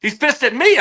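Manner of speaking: ordinary talk, nothing marked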